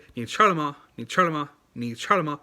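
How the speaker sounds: 你吃了吗 with clear native tones; correct pronunciation. Shown as a demonstration. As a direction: model 你吃了吗 with confidence. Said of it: "Like, you say".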